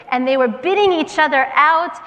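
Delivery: normal talking voice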